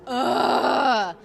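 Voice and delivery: loud fed-up groan after a take; frustrated groan. Retold or imitated. Dancer groaning after the last ten seconds of a pass, loud and fed up. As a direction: let the frustration out in one loud ugh. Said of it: "I was just like".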